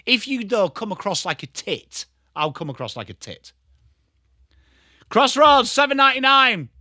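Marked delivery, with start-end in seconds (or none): 5.1-6.7 high, loud sales shout; loud call-out. Acted. Streamer breaking into a mock pitch, loud call-out.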